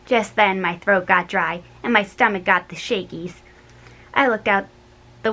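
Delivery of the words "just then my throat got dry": ordinary delivery, nothing marked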